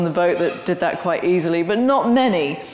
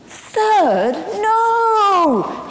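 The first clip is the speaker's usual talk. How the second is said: high, stunned cry of disbelief; high, loud horrified "no"; shocked disbelief; 13 semitones higher, 4 dB louder than usual. Retold or imitated. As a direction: squeal out the shock. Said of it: "And we're going".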